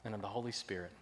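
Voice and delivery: usual speaking voice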